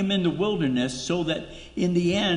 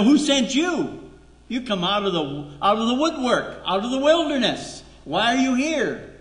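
higher, louder, challenging questions; suspicious crowd; 8 semitones higher, 3 dB louder than usual. Pretend. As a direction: demand to know who sent him, rising. Townspeople doubt returning Moses.

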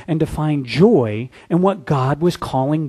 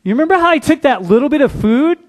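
eager reminding; 6 semitones higher, 4 dB louder than usual. Retold or imitated. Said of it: "they go".

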